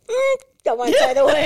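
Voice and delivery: squeaky high mock thought, held back; comic inner voice, stifling a curse. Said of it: "You say".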